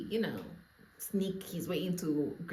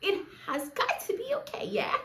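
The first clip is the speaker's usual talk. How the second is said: laughing; 5 semitones higher than usual. Acted. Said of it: "my darling".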